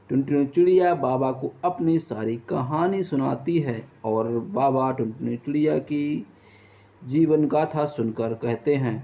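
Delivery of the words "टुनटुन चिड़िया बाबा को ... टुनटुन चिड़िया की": usual speaking voice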